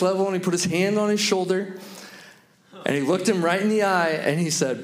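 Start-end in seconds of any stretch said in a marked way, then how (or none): none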